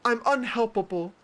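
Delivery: mock self-pity. Mocking attention seekers, high whiny self-pity. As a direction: whine like a drama queen. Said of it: "they're like".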